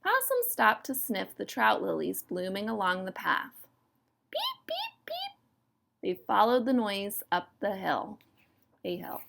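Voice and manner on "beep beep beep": very high, soft little beeps; tiny piping frog peeps, soft and far up high; high peeping. Acted. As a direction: peep softly in a tiny high squeak. The peeper's call, acted.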